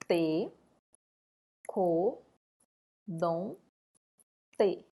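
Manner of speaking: Te, co, dom, te as four isolated beats with long pauses; syllable by syllable. Shown as a demonstration. Teacher shows how the word splits, Te, co, dom, te one at a time. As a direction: separate Te, co, dom, te clearly. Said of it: "Como que eu separo?".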